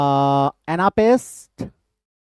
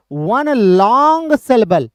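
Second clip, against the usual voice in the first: "long" drawn right out, a little louder; stress on long; 3 semitones higher, 4 dB louder, much slower than usual. Shown as a demonstration. Teacher explains the metrical pattern to students, "long" heavily drawn out. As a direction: stretch "long" slowly and push it. Demonstrating the long syllable of dactyl.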